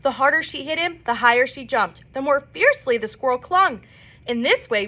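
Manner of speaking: ordinary delivery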